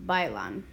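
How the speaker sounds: "bailan" as BAI-lan, set apart and low; weight on BAI in "bailan", pitched low; stress on first syllable. Shown as a demonstration. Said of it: "I like".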